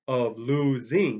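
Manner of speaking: "losing" stretched slowly into three syllables. Retold or imitated. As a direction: drag out "losing" as lo-si-ing. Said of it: "that sounds like three syllables".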